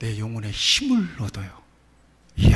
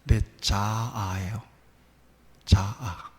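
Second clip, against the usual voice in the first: slow emphasis; 4 semitones lower, much slower than usual. Acted. Slow drawn-out key word.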